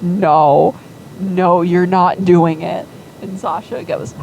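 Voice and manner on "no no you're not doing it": loud refusal. Retold or imitated. Father refusing daughter's plan.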